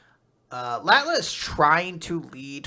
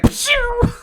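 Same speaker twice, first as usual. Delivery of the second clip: blast sound effect; 9 dB louder than usual. Retold or imitated. Fighter shooting out like Dragon Ball.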